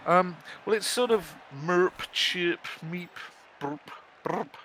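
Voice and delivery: high, squeaky little cat chirps; cute high merps and meeps, softening at the end; Marvin cat noises. Retold or imitated. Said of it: "Oh, you meant the Marvin noises".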